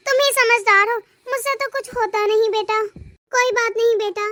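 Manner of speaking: ordinary talk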